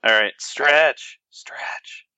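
unvoiced whisper. Real, not acted. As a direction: whisper it, drawn out. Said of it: "filling wait".

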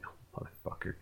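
whispered resigned curse; under breath. Retold or imitated. Narrator cursing to himself, whispered. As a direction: breathe it out in defeat.

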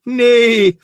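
high, loud, drawn-out neigh; comic whinny on one word; mock horse neigh. Horse pun ending the joke.

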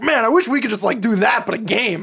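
high, eager nerdy voice; lightly voiced eager gamer; Gary Gygax. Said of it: "Gygax was like".

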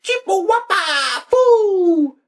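very high, loud squeaky toy voice breaking into laughter; Furbish gibberish. Retold or imitated. His malfunctioning Furby shouting gibberish at night, very high and loud, laughing. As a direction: shriek it like a glitchy party-mad Furby. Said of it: "Mine always spoke half fucking Furbish".

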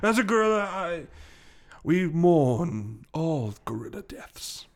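put-on slow solemn declaration in a British accent. Gorilla speaking for all gorillas, slow British accent. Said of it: "am I even doing a British accent".

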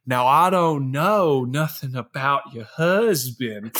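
slow, mock Southern belle drawl; hammed-up Southern accent; bad Scarlett O'Hara Southern accent. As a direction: imitate a badly faked Southern accent, drawn out. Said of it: "trying to do her best Scarlett O'Hara impression".

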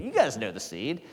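usual speaking voice

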